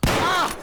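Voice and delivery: high-pitched exclaimed ah; high cry. Acted. Player reacting to the clip, high yelp.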